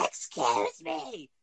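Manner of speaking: high, slow drawn-out excuse me; high, slow mock announcer; ringtone impression. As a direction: stretch it like the ringtone. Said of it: "you'd be like".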